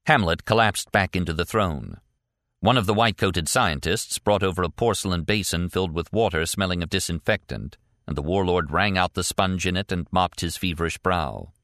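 plain reading voice

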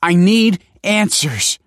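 high, loud, slow demand; drawn-out, insistent cry; desperate cry. A narrator's reading. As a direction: cry out for answers. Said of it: "cried Michael".

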